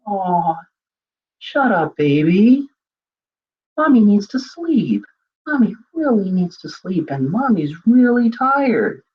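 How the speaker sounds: put-on sweet baby talk. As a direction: coo harsh words sweetly to calm the baby. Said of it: "if she sounded angry and frustrated".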